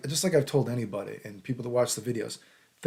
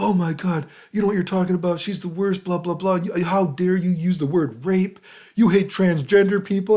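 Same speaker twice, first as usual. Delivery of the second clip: high, loud sarcastic imitation of outraged people; mocking outrage; 7 semitones higher, 5 dB louder than usual. Mocking the people who accused him, high and outraged. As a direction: ham up their shocked accusation, pitched high.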